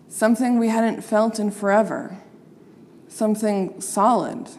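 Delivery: plain reading voice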